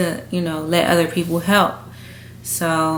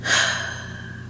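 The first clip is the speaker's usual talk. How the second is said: heavy sigh; 7 dB quieter than usual. Real, not acted. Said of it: "I'm getting emotional".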